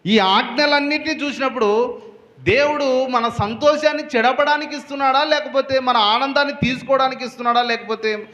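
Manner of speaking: preacher asking the church why God gave the laws; preaching at full force. Acted. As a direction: challenge the congregation with rising fervor. Rhetorical climax.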